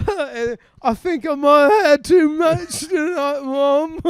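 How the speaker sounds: high, loud tipsy daughter; drunk sister. Retold or imitated. Drunk sister telling mum she overdid it, high and loud. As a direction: slur it to mum.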